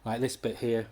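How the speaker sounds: usual speaking voice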